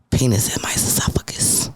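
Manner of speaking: low breathy whisper; sexy voice. Acted. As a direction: whisper it like a seduction. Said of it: "She said that with her sexy voice".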